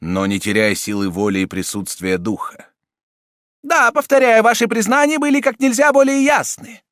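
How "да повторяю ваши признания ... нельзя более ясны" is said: high, loud impatient cardinal; much higher and louder, losing patience; angry impatience. A narrator's reading.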